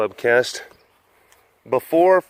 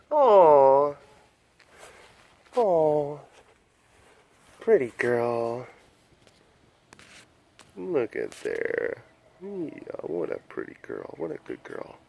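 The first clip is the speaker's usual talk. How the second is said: cooing at tiger; 3 semitones higher, slower than usual. Real, not acted. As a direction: coo to her like a pet.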